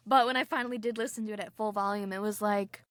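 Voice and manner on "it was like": normal talking voice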